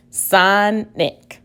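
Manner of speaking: "Sonic" in two separated syllables. Shown as a demonstration. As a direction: sound out "Sonic" slowly, son then nick. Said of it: "The second syllable is ick".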